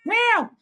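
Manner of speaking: cat imitation pushed up high and loud. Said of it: "My cat meowing".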